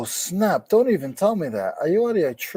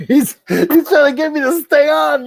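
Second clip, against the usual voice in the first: laughing through the words, pitched much higher; laughing protest; 10 semitones higher, 5 dB louder than usual. Real, not acted. Cohost laughing as the host tries to keep him on the show. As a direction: laugh out the complaint as you catch the trick.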